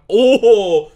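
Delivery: high, loud "oho" of delight; surprised shout; loud surprised whoop. Real, not acted. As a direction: whoop at the prize. Sees the winning amount.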